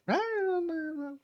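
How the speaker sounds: squeaky high "eh, no, no", half-joking; high mock refusal. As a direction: pitch the refusal way up, playfully. Retold refusing weed offer.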